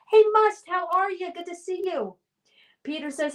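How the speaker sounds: ordinary talk, nothing marked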